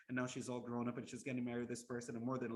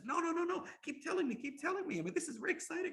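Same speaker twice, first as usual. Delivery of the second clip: high-pitched, louder royal protest; urgent, insistent begging, pitched way up; excited pleading; 4 dB louder, faster than usual. Acted. King begs the storyteller to keep telling, excited pleading.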